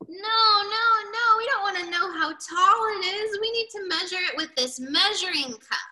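high protest, easing in volume; exasperated correcting. A narrator's reading. Reader voices Rooster correcting the measuring, exasperated. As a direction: start insistent and high, then explain.

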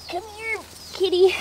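high sweet call to the kitty; calling a cat. Real, not acted.

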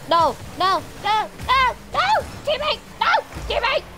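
high, loud cries of alarm; panicked shouting. Real, not acted. Player yelling to teammate mid-play, panicked and high. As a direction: plead in rising panic at a teammate.

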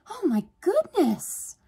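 very high, loud, drawn-out exclamation; delighted amazement. A narrator's reading. Sister discovering a wool skirt, amazed. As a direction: gasp it out slowly, thrilled.

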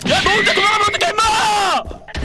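high, fast shout ending in a long scream; outraged yell. Real, not acted. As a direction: yell after a teammate who ran off, stretching the last word. Teammate runs off without player.

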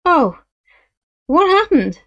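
a thrown rider, stunned on the ground; dazed surprise. Acted. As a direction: ask it dazed after the fall. Dazed after being thrown.

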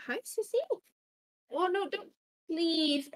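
high, loud pleading to the cat; pet voice. Real, not acted. Streamer begging her cat Sissy not to mess things up, high pet talk. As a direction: coo up high at the kitty.

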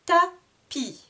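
"Tapis" in two high beats, Ta... pis; split into syllables. Shown as a demonstration. Teacher repeating both syllables, "Tapis" split Ta... pis. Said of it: "Deux syllabes également".

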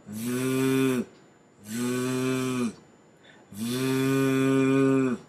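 long steady buzz on "v"; sustained humming "v" like a mixer; vibrating TH sound. Shown as a demonstration. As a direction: drone the voiced TH, "v", on one held note. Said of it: "E esse sim vibra".

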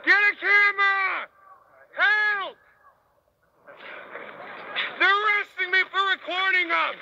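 shouting for help. Real, not acted. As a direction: yell to bystanders. Calling to bystanders.

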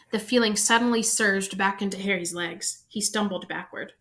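ordinary delivery

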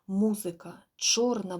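plain reading voice

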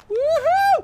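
loud high-pitched "woohoo"; excited whoop. Real, not acted. Gamer cheering his own driving, excited whoop. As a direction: let out a shrill, full-force whoop.

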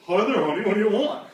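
exaggerated doting boyfriend; annoying boyfriend. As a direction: imitate her equally irritating man. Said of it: "here's her boyfriend".

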